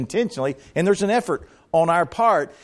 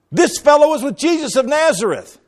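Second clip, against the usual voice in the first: put-on accusing girl; 10 semitones higher, 5 dB louder than usual. Servant girl accuses Peter.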